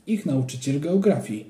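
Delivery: usual speaking voice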